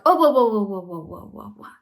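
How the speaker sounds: loud whoa fading to nothing; whoa, pulling the brakes; startled whoa. Acted. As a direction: catch yourself mid-spiral. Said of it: "you're like".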